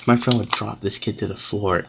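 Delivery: normal talking voice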